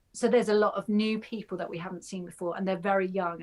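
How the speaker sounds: ordinary delivery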